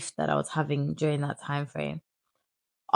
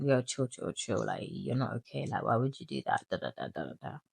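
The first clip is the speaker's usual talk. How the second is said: much lower, softer brother voice; imitating brother; 5 semitones lower, 7 dB quieter than usual. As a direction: talk him down, low and muttered.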